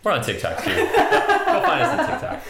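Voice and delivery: laughing. Real, not acted. Playful plug with laugh.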